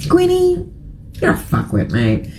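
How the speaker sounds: a mock put-down of a celebrity; mock scolding. Vlogger mocks Gwyneth Paltrow with a dry put-down. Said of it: "I'm like".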